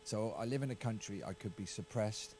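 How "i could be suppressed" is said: ordinary talk, nothing marked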